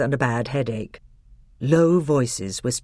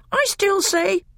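a much higher, pinched voice for the bitter dwarf; sour dwarf, high and hard; 3 dB louder than usual. Acted. Second voice cut off.

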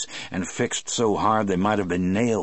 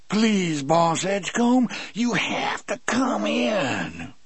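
pleading giant; 12 semitones higher, slower than usual. A narrator's reading. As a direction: beg gently, drawn out. Coffey's pleading voiced quote.

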